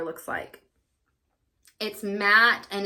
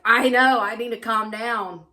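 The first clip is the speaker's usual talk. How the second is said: cheeky agreement; 3 semitones higher, 8 dB louder than usual. Retold or imitated. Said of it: "And I'm like".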